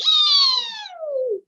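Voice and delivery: tiny squeak. Acted. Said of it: "let out a".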